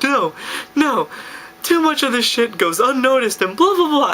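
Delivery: high, hammed-up drunken protest; imitated bawling protest. Bawling drunk refusing a friend's "let's go", mock outburst. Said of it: "I'm bawling my eyes out".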